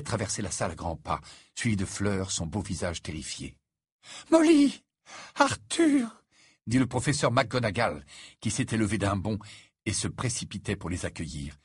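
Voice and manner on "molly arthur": high, loud cry of greeting; startled greeting. A narrator's reading. Professor rushing to greet the worried parents, high startled cry. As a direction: call out their names, high and urgent.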